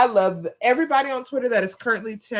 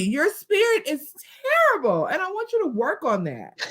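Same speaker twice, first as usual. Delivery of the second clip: mock scolding; 12 semitones higher than usual. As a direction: scold the absent villain in exaggerated indignation. Mock scolding of absent wrestler.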